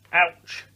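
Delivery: a sharp, high, loud ouch; yelp of pain. A narrator's reading. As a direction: cry out as the splinter bites. Douglas hugs splintery tree.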